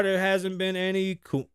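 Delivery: normal talking voice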